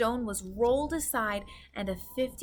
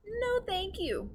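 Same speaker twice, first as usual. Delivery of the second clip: Sophie declining; 11 semitones higher than usual. A narrator's reading. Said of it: "backing away".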